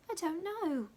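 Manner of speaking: put-on high girlish voice; plain innocent answer; little maid not knowing her age; small servant. A narrator's reading.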